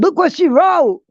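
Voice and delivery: very high, loud, urgent; urgent sister. Retold or imitated. Sister shows the speaker the text, high and urgent. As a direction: push the phone at her, high and insistent.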